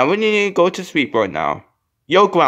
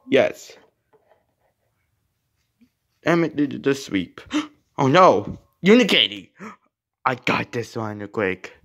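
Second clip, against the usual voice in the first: ordinary delivery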